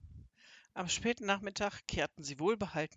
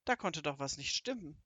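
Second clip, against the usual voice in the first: ordinary delivery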